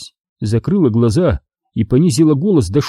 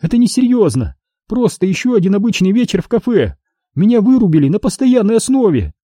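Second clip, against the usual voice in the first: Astri voiced high; 9 semitones higher than usual. A narrator's reading. Dazed daughter asks her father what happened, pitched high.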